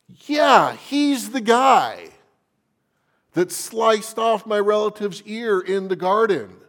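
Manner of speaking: high, loud, pointed accusation; accusing relative. Acted. Malchus's relative accusing Peter in the courtyard, loud and high.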